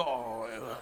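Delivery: low, soft trailing groan; mock woozy moan; drunken groan. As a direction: let out a long low "oh", sinking away. Acts out drunken arrival groan.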